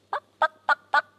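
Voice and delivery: short, high imitated clucks; little staccato chicken noises; soft hen clucks. A narrator's reading. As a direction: cluck lightly like a group of hens. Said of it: "they began to cluck softly".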